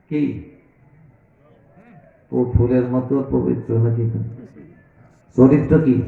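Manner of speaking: ordinary delivery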